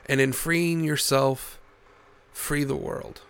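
soft, slow closing message; heartfelt sign-off. Acted. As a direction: speak it like a quiet creed.

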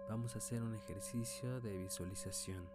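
calm, unhurried meditation guide; guided meditation. Acted. Leads a meditation. (voz de meditación guiada)

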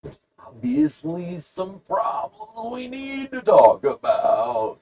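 high, slow, hammed-up concern; exaggerated drawn-out high pitch; mock-dramatic. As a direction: stretch it out in a high silly voice. Hammed-up reaction to angry buddy.

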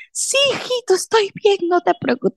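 high, breathless-sounding reassurance; mock shaken mom. Mother answering a bystander, high mock-calm reassurance. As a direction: pretend everything is fine.

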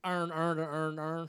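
loud, exaggerated Baltimore "earn"; mock Baltimore accent. Riffing on Baltimore with friends, loud mock Baltimore accent. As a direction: repeat "earn" loudly in a comic Baltimore accent.

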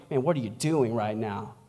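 low, soft confrontation; hushed, caring reproof; loving rebuke. Acted. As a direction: quietly call out a friend. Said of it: "When someone rebukes you in love".

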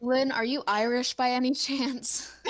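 ordinary delivery

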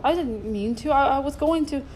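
faltering, mildly moaned excuse; moaning. A narrator's reading. Accused man pleads he didn't mean it, mildly moaning. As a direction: stumble through a feeble excuse. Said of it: "Tupper moaned".

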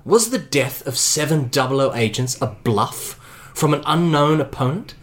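steady game master narration of Bond's doubts; story narration. A narrator's reading. Game master narrating Bond's doubts to the players, measured. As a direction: read it like a thriller narrator.

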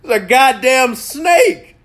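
half laughing, half yelling in disbelief; incredulous rant. Real, not acted. Telling viewers about the snake, shouting in laughing disbelief.

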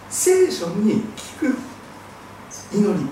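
ordinary delivery

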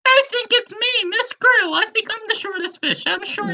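high little krill voice; Miss Krill. Acted. Tiny fish claims to be the shortest, high put-on voice. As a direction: pipe up, far above your own pitch. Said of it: "putting on the voice for Missus Krill".

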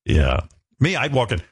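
ordinary talk

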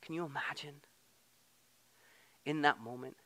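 hushed whisper of wonder; whispered awe. Real, not acted. Preacher marvelling at Jesus naming Mary, whispered. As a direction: breathe it in awe.

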